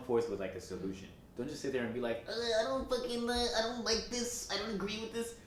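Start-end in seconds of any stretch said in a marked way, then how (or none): 2.3-5.3 high mocking whine of a useless complainer; whiny complainer. Mocking a complainer with a high whine. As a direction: whine it like someone who only complains.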